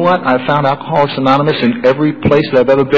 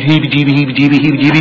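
mock droning reading; faster than usual. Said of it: "the heebie-jeebie stuff that they always read".

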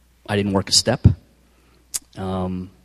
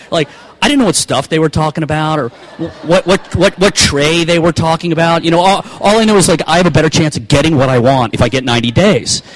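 louder and higher, words tumbling out; getting worked up, pushing it out fast; excited rush; 7 semitones higher, 6 dB louder than usual. Real, not acted. To the listeners, worked up over the tray, excited and loud.

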